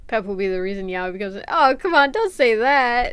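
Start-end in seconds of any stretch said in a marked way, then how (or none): 1.5-3.1 exasperated protest. Real, not acted. Protesting chat.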